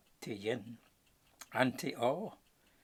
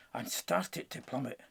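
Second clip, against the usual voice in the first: ordinary delivery, nothing marked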